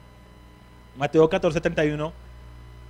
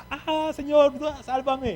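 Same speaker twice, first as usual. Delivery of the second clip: high, strained cry for rescue, pitched way up; desperate cry; 11 semitones higher, slower than usual. Shown as a demonstration. Preacher shows Peter sinking, crying out high and desperate. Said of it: "¿cómo fue eso?".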